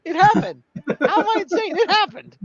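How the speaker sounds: high, laughing mock protest; laughing insistence. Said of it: "I'm like".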